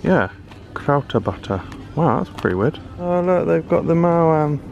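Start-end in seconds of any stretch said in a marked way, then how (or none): none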